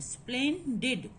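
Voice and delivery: "Splendid" in two distinct beats, splen, did; split into syllables. Shown as a demonstration. Teacher pointing out the second syllable, "Splendid" said in parts. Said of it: "This is the second syllable".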